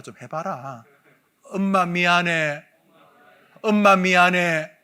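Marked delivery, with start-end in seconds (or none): 1.4-4.7 slow, louder apology called out; group shouting sorry to mother. Shown as a demonstration. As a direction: call the apology out slowly and loudly. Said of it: "한번 따라합시다".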